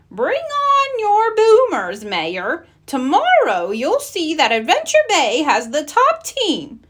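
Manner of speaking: high, slow dare to the rival; proud mayor accepting the challenge; bold challenge. Acted. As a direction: throw down the gauntlet.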